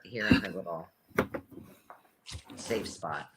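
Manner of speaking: hushed and low, not to wake her; gentle near-whisper; soft hushed voice. Real, not acted. Talking softly over sleeping pet.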